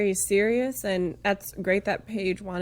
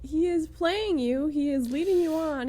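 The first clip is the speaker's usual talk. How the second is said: exasperated pleading; 6 semitones higher than usual. Acted. Imagined pleading with Paige.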